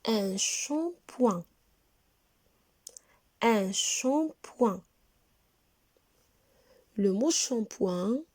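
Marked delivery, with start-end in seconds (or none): 0.0-1.4 shampoing said very slowly; slow teacher model of shampoing; slow model word. Shown as a demonstration. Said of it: "Qu'est-ce que c'est?". 3.4-4.8 shampoing said very slowly; slow teacher model of shampoing; slow model word. Shown as a demonstration. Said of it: "Qu'est-ce que c'est?".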